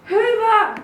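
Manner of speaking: high, loud panicky cry; scared mouse crying out; frightened cry. Acted. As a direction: yelp in fear at the noise. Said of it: "cried the horrible little mouse".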